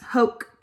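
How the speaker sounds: "hoke" loud, rhyming with coke; pronunciation demo. Shown as a demonstration. Teacher pronouncing Latin for parents, "hoke" rhyming with coke. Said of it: "like a coke".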